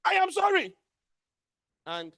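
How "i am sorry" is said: high, loud, forceful "I am sorry". Shown as a demonstration. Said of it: "There's a difference between".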